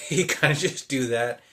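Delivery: giggling while showing the gesture; laughing. Real, not acted. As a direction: laugh your way through it. Laughs while imitating father.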